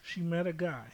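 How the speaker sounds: usual speaking voice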